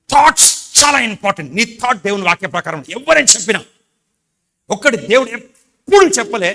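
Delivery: loud, high, fiery declaration; preaching at full force. Acted. Pastor insists God never promised early death, loud preaching. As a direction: thunder it to the church.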